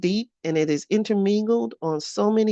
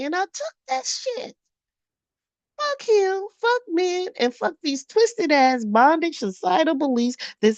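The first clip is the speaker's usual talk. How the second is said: furious rant; 12 semitones higher than usual. Retold or imitated. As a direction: rant at yourself after being bullied. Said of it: "I'm like".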